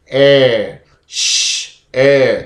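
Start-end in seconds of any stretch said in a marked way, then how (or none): 1.1-1.8 a whispered sh, no voice; sh sound. Shown as a demonstration. Teacher showing the sh sound, unvoiced. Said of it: "So sh".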